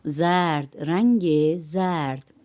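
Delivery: زرد drawn out slowly with a long open a; stretched vowel. Shown as a demonstration. Teacher repeats the colour for learners, slow stretched vowel. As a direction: stretch the a each time in زرد. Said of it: "زبر کو ذرا کھینچ کے".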